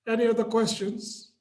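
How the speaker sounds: ordinary talk, nothing marked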